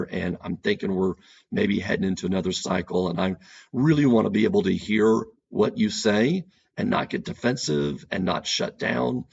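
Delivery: gently slow the fight down; soft, slow tone. Shown as a demonstration. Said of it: "Notice the softness of my tone. Notice how I slowed".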